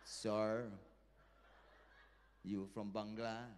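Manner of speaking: put-on low Filipino-accented proposition. Filipino mate propositioning the comedian, in Filipino accent. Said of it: "Filipino mates come up to me".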